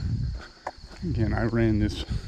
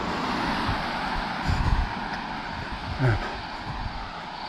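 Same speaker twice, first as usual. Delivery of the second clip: long tired sigh; 5 dB quieter than usual. Real, not acted. Long sigh mid-walk.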